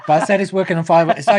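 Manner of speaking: laughing. Real, not acted. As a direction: defend the joke while laughing. Laughing at own KFC remark.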